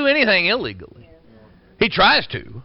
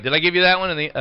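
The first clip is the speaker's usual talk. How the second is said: ordinary delivery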